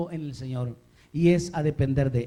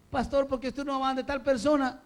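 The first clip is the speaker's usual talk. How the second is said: higher-pitched questioning of the pastor; lightly acted church member; 7 semitones higher than usual. Member questions the pastor's choices, lightly acted and higher. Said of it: "A veces usted puede decir".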